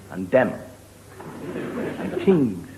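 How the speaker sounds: "them" as dem, "things" as tings; Irish TH as D/T. Shown as a demonstration. Comic showing Irish speech, "them" and "things" with hard D and T. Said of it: "the Irish, we have trouble with, uh, the T and the H".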